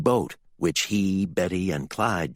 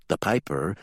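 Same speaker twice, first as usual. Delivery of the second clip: ordinary delivery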